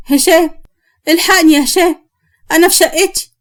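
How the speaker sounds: high, anxious call for help; panicked plea. Acted. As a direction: plead urgently to be rescued. Frightened woman phones friend for rescue.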